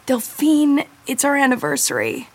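dismayed realization. Acted. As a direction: realize too late and blurt it out. Sudden realization of anniversary.

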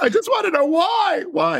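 high, loud melodramatic wail; Steel Magnolias wail. Retold or imitated. Grieving child at parents' grave, reciting Steel Magnolias, hammed-up high wail. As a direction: wail it like a movie graveside breakdown. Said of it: "here you are reciting Steel Magnolias".

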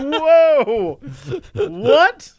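baffled and thrown; mock startled what; confused surprise. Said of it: "I was like".

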